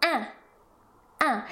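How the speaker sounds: "un" as one nasal sound; short French nasal "un"; French nasal vowel. Shown as a demonstration. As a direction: say "un" through the nose without a separate n. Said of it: "is forming the nasal".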